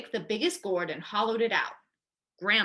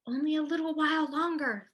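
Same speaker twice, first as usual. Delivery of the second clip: Grandma thinking; 6 semitones higher than usual. A narrator's reading. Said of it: "thought Grandma".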